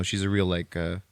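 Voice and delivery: normal talking voice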